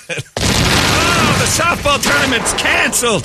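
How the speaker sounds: drawn-out high falsetto wail; mock dismayed player. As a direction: lament it like a let-down little girl. Mock wail after imagined explosion.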